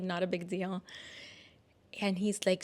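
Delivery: usual speaking voice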